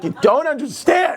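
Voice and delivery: high-pitched mock exasperation; exasperated retort. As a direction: plead with mom, at wits' end. Exasperated retort to mom in story.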